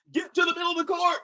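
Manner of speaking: high, fast called instruction; coaching call. Retold or imitated. Coach calling out to a player during the game, high and fast. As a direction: call it across the court.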